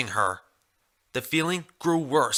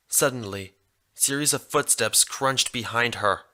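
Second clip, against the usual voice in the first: ordinary delivery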